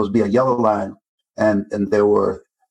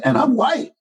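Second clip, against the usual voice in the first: mock bigot voice; 14 semitones higher than usual. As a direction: lightly sneer it out as the bigot. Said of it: "He said".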